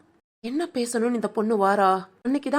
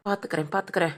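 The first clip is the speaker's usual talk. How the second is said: muttered threat; 7 semitones lower, 6 dB quieter, faster than usual. Acted. Grumbling threat to herself.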